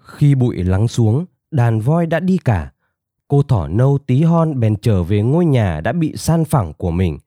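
plain reading voice

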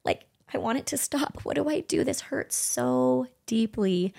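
a touch of remembered anguish; retold anguish. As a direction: let the hurt stretch the last words. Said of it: "it was excruciating".

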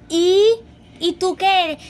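retold loud comeback to a zodiac-obsessed friend; mock indignant retort. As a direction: fire back at the zodiac snob. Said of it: "Y yo".

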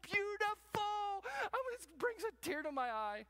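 high, loud, overly emotional gushing; mock tearful rapture over a chair; gushing chair lover. Preacher mocking a man emotional about a chair, gushing high. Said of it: "And I can say".

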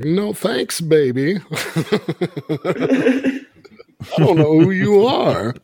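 high, slow cool-cat voice. Retold or imitated. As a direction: play the smooth funk star. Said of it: "I'll be like".

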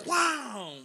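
loud, high smack sound effect; imitated whack. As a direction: punch out the noise of a hit landing. Imitates the first hit landing.

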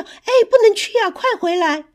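put-on high, loud, urgent cry after the children; anxious calling. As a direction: shout for them to come back, panicked. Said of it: "爸爸妈妈着急地叫".

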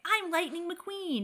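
high, slow, hammed-up race car boast; mock-heroic Lightning McQueen boast. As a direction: puff up like a cartoon race car. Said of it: "you can be going forty and be like".

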